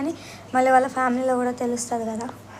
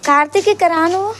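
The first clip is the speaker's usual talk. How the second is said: loud, high call to come over; raised, insistent call; 6 semitones higher, 8 dB louder than usual. Real, not acted. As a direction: summon a friend from off to the side. Calls him to come here.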